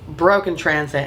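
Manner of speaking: usual speaking voice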